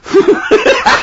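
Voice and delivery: sudden high-pitched laugh; high burst of laughter. Real, not acted. Laughs at cheese on trifle.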